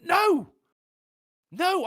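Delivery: exasperated acted-out objection; exasperated refusal. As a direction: refuse forcefully, acting out your own protest. Retold refusal to drunk mate.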